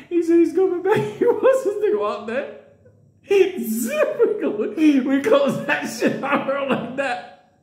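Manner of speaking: high voice through laughter; high giggling narration; laughing. Real, not acted. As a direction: laugh while telling it. Laughing retelling panic.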